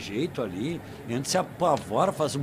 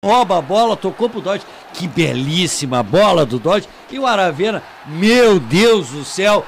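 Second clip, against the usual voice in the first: much louder, thrilled; excited; 11 dB louder than usual. Real, not acted. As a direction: burst out in delight. Excited reaction to goal.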